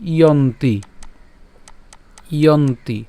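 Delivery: ইয়ন্তি with the y glide sounded, "i-yonti"; model pronunciation. Shown as a demonstration. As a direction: say ইয়ন্তি carefully as "i-yon-ti". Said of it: "তাহলে তার উচ্চারণ কেমন হবে".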